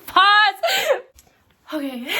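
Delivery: high, loud, tearful cry of pause; laughing shout. Real, not acted. Vlogger halting herself mid-cry, laughing loudly. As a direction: shout it to stop the tears. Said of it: "I'm gonna start crying".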